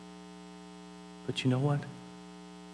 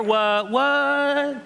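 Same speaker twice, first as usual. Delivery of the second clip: puzzled mom; 11 semitones higher, 3 dB louder than usual. Retold or imitated. Deaf mom reacts to news.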